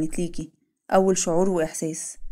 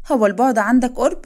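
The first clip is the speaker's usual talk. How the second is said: high, loud teasing challenge; playful teasing; 5 semitones higher, 6 dB louder than usual. Acted. Wife teasing her husband as he edges closer, loud and high. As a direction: tease him, pitched up and loud.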